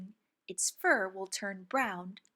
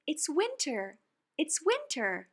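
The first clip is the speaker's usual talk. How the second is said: joyful cheer; 7 semitones higher than usual. Acted. Children celebrating winter.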